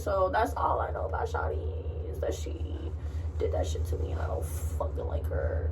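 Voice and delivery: hushed, breathy whisper of a grudge; whispered aside. Real, not acted. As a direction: lean in and whisper it like a secret. Whispered aside about classmate.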